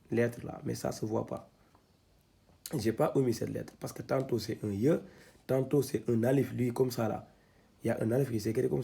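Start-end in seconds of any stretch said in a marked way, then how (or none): none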